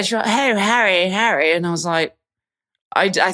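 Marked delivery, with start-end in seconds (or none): 0.2-1.5 high-pitched mock British accent; fake British accent. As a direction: imitate a park fan putting on British. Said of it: "everyone puts on a British accent".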